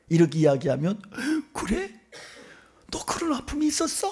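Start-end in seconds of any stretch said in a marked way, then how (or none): 1.5-4.1 high, airy astonished reply; hushed surprise. Acted. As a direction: react amazed in a high, soft voice. Sufferer reacts to shared pain.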